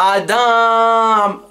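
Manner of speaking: high, long mock scream; sustained holler on a high note; mom screaming. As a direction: imitate mom's name call. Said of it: "Your cause of screaming out".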